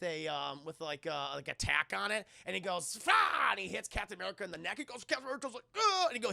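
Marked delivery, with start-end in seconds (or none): 3.0-3.5 mock attack grunt. Red Skull stabs with ring. 5.8-6.1 pained grunt. Retold or imitated. Cap hit in neck.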